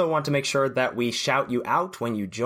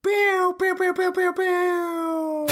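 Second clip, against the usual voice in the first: squeaky mock air horn sounds; air horn noise; much slower than usual. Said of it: "Can I do an air horn?".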